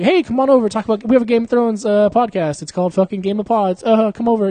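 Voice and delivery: high, loud, over-eager host pitch; luring invitation. Acted. Host pretending to lure an actress over, high eager call. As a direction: coax her over with hammed-up friendliness. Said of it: "luring her into a trap".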